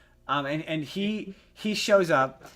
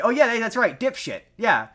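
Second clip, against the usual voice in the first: mock correction; 8 semitones higher, 5 dB louder than usual. Imagined sketch, mocking reply.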